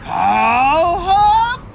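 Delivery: loud, high, strained huffing; straining coyote. Acted. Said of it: "Again, Coyote tried".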